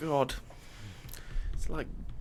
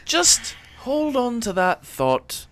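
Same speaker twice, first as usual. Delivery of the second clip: high, loud, haughty approval; snooty sarcastic praise; 7 semitones higher, 9 dB louder than usual. Acted.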